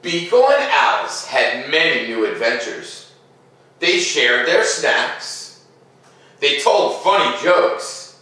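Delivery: put-on gentle read-aloud to children; storybook narration. A narrator's reading. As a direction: tell it like a bedtime story. Reads picture book aloud.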